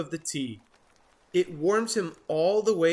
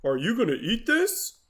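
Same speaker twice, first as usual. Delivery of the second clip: lightly raised neighbor voice; Norman voice; 6 semitones higher than usual. Acted. Narrator voices Norman asking Jim. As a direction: ask it as curious Norman.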